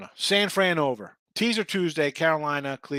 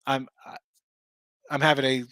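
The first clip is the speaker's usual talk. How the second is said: condescending; 2 semitones lower, 6 dB louder than usual. Pretend. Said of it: "like, condescending".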